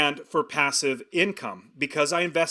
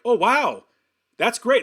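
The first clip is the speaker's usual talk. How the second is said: mock-impressed "oh wow", higher and louder; 8 semitones higher, 5 dB louder, slower than usual. People hearing he's an investor, impressed and excited. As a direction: play someone suddenly impressed by the news.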